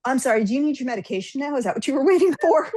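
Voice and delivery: acted snarky nurse; mock concern. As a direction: needle them with fake solicitude. Acted nurse mocking the baffled agent.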